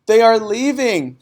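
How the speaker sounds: high, loud, panicked cry; child running in with bad news; breathless cry. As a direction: blurt it out of breath. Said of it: "she cried".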